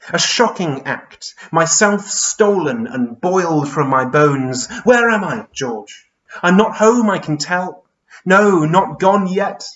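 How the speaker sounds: slow, drawn-out outrage rising to loud, high alarm; Charles Byrne, distressed. Acted. Stripped giant asks George where he is, distressed. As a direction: dwell on each word, then cry out lost.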